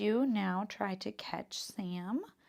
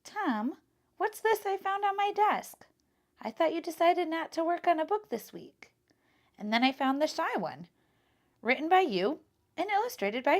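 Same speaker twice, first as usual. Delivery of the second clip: high, louder lively librarian voice; librarian's voice; 9 semitones higher, 7 dB louder than usual. Acted. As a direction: play the excited librarian calling Tom over. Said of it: "Then he heard the librarian's voice".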